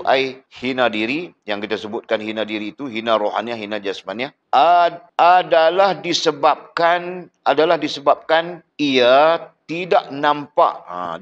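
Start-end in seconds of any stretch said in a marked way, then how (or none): 4.5-10.8 slow reading. Shown as a demonstration. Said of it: "teacher reading the text aloud".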